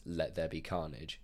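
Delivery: a hint of a menacing villain line; mild mock brooding villain. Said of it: "in a very brooding voice".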